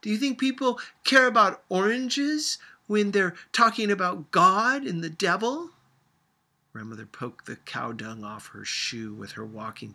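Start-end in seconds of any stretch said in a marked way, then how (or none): 0.0-5.7 loud, scornful high retort; contemptuous. A narrator's reading. Sophia scornfully rebuking grandmother, loud and contemptuous. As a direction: sneer at the idea. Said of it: "said Sophia contemptuously".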